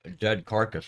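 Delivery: loud, startled exclamation; surprised. Real, not acted. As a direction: blurt it out in alarm. Startled reaction to game event.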